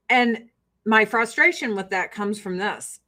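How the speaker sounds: ordinary talk, nothing marked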